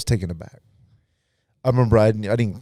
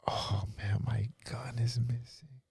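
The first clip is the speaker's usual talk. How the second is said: whispered and slow, voice pitched up; slow, breathy whisper of alarm; 4 semitones higher, 10 dB quieter, slower than usual. Retold or imitated. Discovering gun missing.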